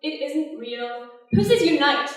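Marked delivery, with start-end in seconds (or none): 1.3-2.1 loud, higher slogan shout; rallying cry. Acted. Woman mocks feminist slogans, rallying shout. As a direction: shout it like a protest chant.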